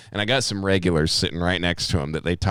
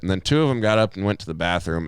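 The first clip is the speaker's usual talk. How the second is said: normal talking voice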